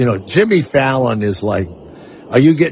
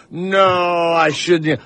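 meek mock demurral; mock modesty; 3 semitones higher, much slower than usual. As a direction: imitate a bashful refusal, drawn out. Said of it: "Instead of this".

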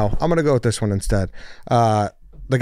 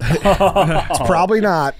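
laughing; 7 semitones higher, much slower than usual. Real, not acted. Laughs while answering.

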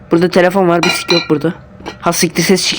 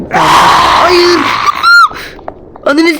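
terrified scream; 12 semitones higher, much slower than usual. Real, not acted. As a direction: scream as you get caught. Caught again, screams. (dehşet içinde bağırış)

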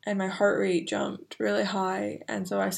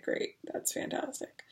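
sarcastic; 8 semitones higher, 10 dB quieter than usual. Retold or imitated. Sarcastic reply to bad news.

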